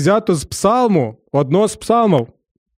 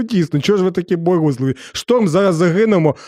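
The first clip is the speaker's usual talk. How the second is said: ordinary talk, nothing marked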